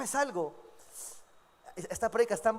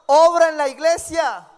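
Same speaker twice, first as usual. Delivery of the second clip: loud, slow, higher pleading prayer; fervent prayer; 6 semitones higher, 12 dB louder than usual. Acted. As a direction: cry out to God, slow and loud. Acted prayer pleading with God. (oración ferviente en voz alta)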